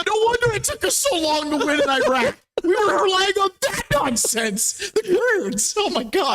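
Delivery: crying with laughter, high-pitched; laugh-crying mock disbelief; laughing hard. Real, not acted. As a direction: squeal the words through helpless laughter. Laughing through mocking remark.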